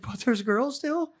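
mimicking a wasted buddy asking again; dazed tripping friend. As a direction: ask it like a confused, tripping friend. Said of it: "He's like".

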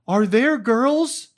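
mimicking a wasted buddy popping up to ask; dazed tripping friend. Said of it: "pop up every now and again and be like".

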